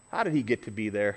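usual speaking voice

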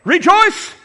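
high, loud burst of rejoicing; exultant cry. Acted. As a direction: cry it out like Paul exhorting. Acting out Paul's exhortation.

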